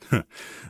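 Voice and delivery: unvoiced snicker; a secret stifled chuckle; breathless chuckle. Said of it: "I fairly chuckled at the idea".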